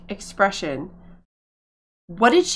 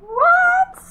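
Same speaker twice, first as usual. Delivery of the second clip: shocked squeal; 8 dB louder than usual. Real, not acted. Startled by Sims' antics.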